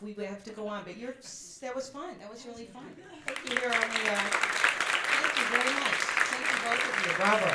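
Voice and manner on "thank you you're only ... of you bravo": loud. Real, not acted. Loud applause thanks.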